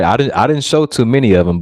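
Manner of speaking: normal talking voice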